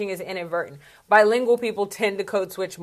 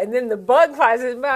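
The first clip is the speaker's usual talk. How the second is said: usual speaking voice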